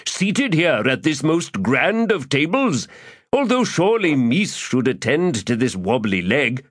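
florid, theatrical gentleman rogue; lofty, self-pleased orator; pompous Crupper voice. Acted.

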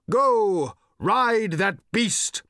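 loud, high, slow bellow; shouted. A narrator's reading. Old man urging departing riders, drawn-out shout. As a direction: holler a long-drawn command after them. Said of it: "shouted Thrashbarg".

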